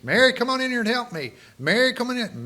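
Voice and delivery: high, fast, loud mock calling; calling Mary. Martha calls Mary many times from the kitchen, loud. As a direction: call your sister from the next room, hammed-up. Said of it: "Maybe that was the case".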